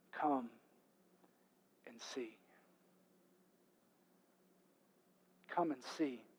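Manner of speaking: soft, low, spaced-out words; tender invitation. Acted. Philip answering Nathanael's doubt with an invitation, tender. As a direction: invite quietly, leaving long pauses. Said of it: "Philip's response is so beautiful".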